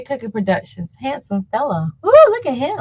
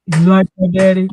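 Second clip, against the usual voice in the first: mock deepened voice, louder; deep voice; 3 semitones lower, 5 dB louder than usual. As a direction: briefly put on a deeper man's voice. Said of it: "his voice got deep or something".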